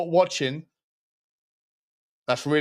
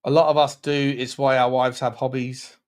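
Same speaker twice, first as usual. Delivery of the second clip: normal talking voice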